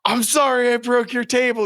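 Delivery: loud, high-pitched apology, retold; mock panic. Guest apologizes to basement owner for broken table, mock panic. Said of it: "I, like, panicked".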